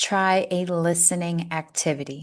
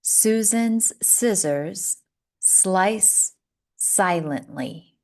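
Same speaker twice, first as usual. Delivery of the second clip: slow model reading; slower than usual. Shown as a demonstration. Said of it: "One more".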